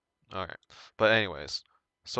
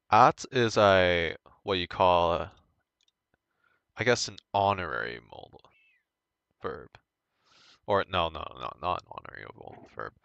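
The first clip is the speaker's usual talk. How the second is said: usual speaking voice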